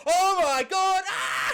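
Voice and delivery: high-pitched drawn-out squeal; giddy shriek breaking into laughter; overexcited fan scream. Pretend. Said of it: "maybe I'm just way too excited".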